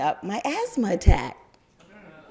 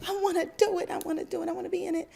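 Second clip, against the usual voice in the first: put-on high whiny kid begging; childish pleading; 14 semitones higher, much faster than usual. Little girl begging her mother to join, high and whiny. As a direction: beg like an eager little girl.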